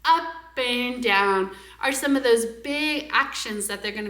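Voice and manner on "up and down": slow, stretched up and down; slow exaggerated. Shown as a demonstration. Teacher modeling for parents, slow and stretched. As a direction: draw out each up and down. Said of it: "big movements".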